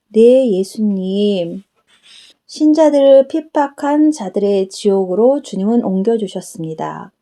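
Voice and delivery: ordinary delivery, nothing marked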